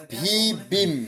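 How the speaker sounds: "ভি বিম" very slowly, syllables held long; pronunciation correction. Shown as a demonstration. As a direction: hold "ভি" with bh, then plain-b "বিম". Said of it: "আবার উল্টা হচ্ছে".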